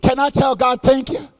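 loud, rising preacher's climax over the music; shouting, half-singing gospel preacher whooping to the congregation; full-force preaching. Acted. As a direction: preach it out at full force, calling the church to praise.

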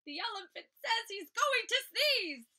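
high, loud squawking parrot; parrot shriek. A narrator's reading. Parrot shrieking to the animals, high loud squawk. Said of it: "With a shriek".

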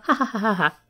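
deliberate, spoken-out "ha ha ha"; mock gloating laugh. Mock gloating before "take that.

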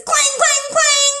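high loud clanging; clang noise. Acted. The boy makes noise, high loud clang. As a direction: ring it out like a bell.

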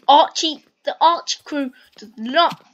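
loud, fired-up boast; loud hype. Real, not acted. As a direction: shout it proudly. Loud hype about his crew.

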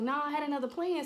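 defensive re-enacted insistence; insistent excuse. Excuse repeated to dad.

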